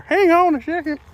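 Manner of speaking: high, loud burst of surprise; sudden excitement. Real, not acted. Angler surprised by a sudden bite, high excited yelp. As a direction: caught off guard by a strike, yelp it out. Big fish strikes suddenly.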